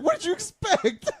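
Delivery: high, slow, laughing "what did you expect"; laughing disbelief. Retold or imitated. Telling his friend what he said to her, high laughing disbelief. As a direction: squeal it through laughter in disbelief. Retold exasperated reply, laughing.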